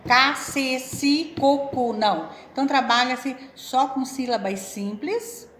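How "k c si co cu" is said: loud, crisp "Co", "Cu", "Si"; syllable demo. Shown as a demonstration. Teacher sounding out C syllables for a child. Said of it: "essa lógica".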